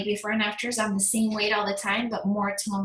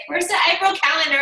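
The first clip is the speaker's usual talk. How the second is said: higher, louder mock call from the team; impatient team demand; 6 semitones higher, 7 dB louder than usual. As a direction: demand the calendar like an impatient teammate. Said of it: "my team's like".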